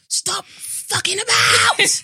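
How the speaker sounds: high, slow furious shout. Retold or imitated. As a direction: yell it at unruly brothers. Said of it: "I used to shout".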